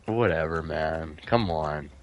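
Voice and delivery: deflated. Real, not acted. Giving up after the hit.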